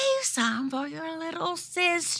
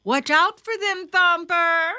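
mother calling out; 9 semitones higher, 5 dB louder than usual. A narrator's reading. Mother calls instructions to Thumper.

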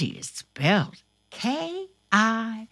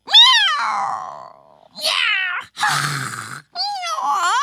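high, loud meows; cat meowing; 12 semitones higher, 5 dB louder, much slower than usual. Acted. Host meowing as the kitty in the story. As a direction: meow like a small cat.

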